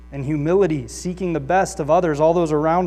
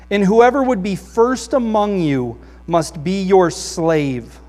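loud, raised scripture reading; 2 semitones higher, 6 dB louder than usual. Acted. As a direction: proclaim the passage to the congregation. Reads scripture aloud.

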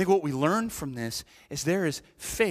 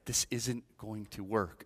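softer, slower, resigned; quieter hopeless repeat; 6 semitones lower, 7 dB quieter, slower than usual. Retold or imitated. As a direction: say it again, giving up. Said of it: "you're just like".

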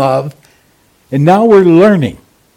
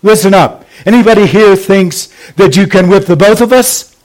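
drill sergeant boast; 5 semitones higher than usual. Retold or imitated. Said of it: "He said".

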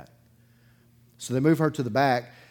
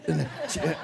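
a burst of real laughter; laughing. Real, not acted. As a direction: laugh it out at yourself. Laughing at his own story.

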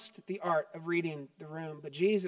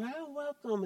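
much higher, soft sugary greeting; sugary welcome; 8 semitones higher, 6 dB quieter than usual. Retold or imitated. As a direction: gush over the guests at the door. Greeting guests at the door.

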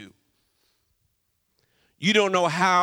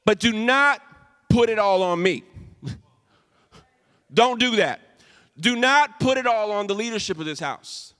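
firm loud warning; 4 semitones higher, 6 dB louder than usual. Real, not acted. Pastor warns congregation firmly.

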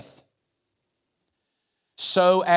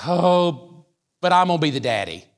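ordinary delivery

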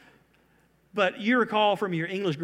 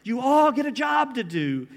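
loud, rising Oprah talk-show giveaway shout; 4 semitones higher, 7 dB louder than usual. Pretend. Preacher jokes as Oprah handing out missions, loud giveaway voice. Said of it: "If Jesus were Oprah".

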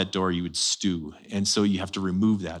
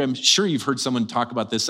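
ordinary delivery

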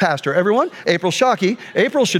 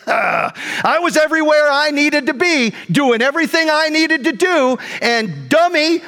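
high, emphatic self-righteous rant; retold fuming anger; 8 semitones higher than usual. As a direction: replay fuming at being wronged. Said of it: "I was that fuming mad".

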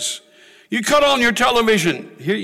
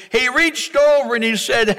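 ordinary talk

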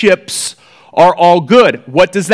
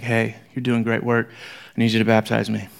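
lower, softer casual request; soft imagined Jesus; 8 semitones lower, 9 dB quieter than usual. Retold or imitated. Jesus imagined asking John, softer and lower. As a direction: say it lightly, almost confiding. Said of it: "Can you imagine Jesus coming up and being like".

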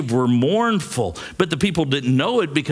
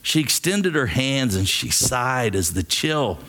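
ordinary delivery

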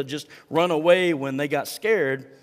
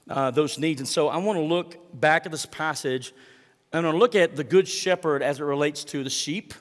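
usual speaking voice

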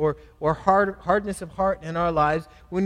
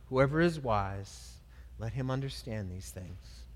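softer, lower measured reading of the verses; scripture reading; 4 semitones lower, 6 dB quieter than usual. Acted. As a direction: read the passage quietly and solemnly. Reads the Bible passage.

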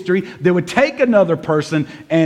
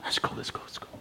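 hushed, lowered aside with a psst; near-whispered, confidential summons; discreet beckoning; 4 semitones lower, 7 dB quieter than usual. Acted. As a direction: call them over on the quiet. Paul beckoning preachers aside discreetly.

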